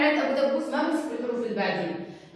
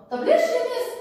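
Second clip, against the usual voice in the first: high, louder pupil's question; pupil asking teacher; 10 semitones higher, 4 dB louder than usual. Acted. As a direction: pipe up as a questioning schoolchild. (صوت تلميذ عالي يسأل المعلمة)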